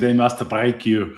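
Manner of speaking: slow, put-on Russian accent. Shown as a demonstration. Co-host demonstrates a movie line, slow Russian accent. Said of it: "You gotta say that in a Russian accent".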